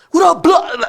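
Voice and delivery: high, loud, mock gangster holler; imitated shout. Friend imitating the rapper's sudden transformation, shouting. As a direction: holler it high like a showy street greeting. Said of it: "he's shouting at the dudes".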